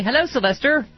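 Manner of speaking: ordinary delivery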